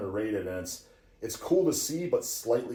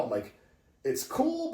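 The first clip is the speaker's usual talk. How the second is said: normal talking voice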